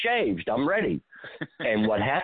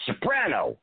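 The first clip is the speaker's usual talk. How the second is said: retold yell; 7 semitones higher than usual. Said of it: "So I yell to him".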